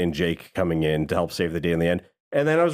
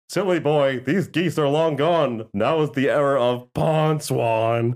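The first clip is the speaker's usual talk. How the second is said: slow, high, hammed-up villain voice; slow theatrical villain menace; 4 semitones higher, slower than usual. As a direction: drawl it out like a cartoon villain. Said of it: "my impression is one I already did".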